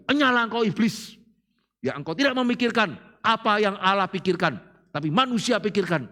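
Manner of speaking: stern rebuke. Retold or imitated. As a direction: scold Satan away with force. Jesus rebukes Peter.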